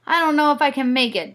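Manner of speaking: fast, loud worried Dad. Acted. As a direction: rush it out anxiously. Dad struggling up mountain.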